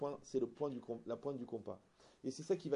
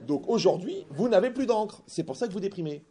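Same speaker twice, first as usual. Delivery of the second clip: plus fort et plus haut, tranchant; asséner la conclusion; verdict lancé; 6 semitones higher, 11 dB louder than usual. Retold or imitated. Conclut la parabole à la femme. (annonce à la femme, plus fort et plus aigu)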